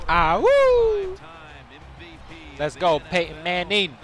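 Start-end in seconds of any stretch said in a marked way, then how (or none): 0.1-1.2 high falsetto whoop; excited whoop. Real, not acted. Celebrating during game.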